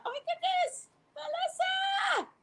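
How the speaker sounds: very high, drawn-out call; excited squeal. Real, not acted. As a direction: squeal the name out long and high. Calling out excitedly to arriving guest.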